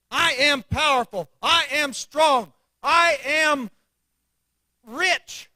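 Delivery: with conviction. Shown as a demonstration. Preacher to congregation, showing how to say it, loud and sure. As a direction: proclaim each one like you own it. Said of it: "Say it".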